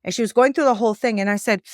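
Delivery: normal talking voice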